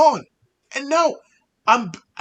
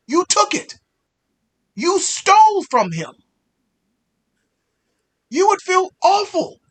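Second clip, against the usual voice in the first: angry; 4 semitones higher, 8 dB louder than usual. Acted.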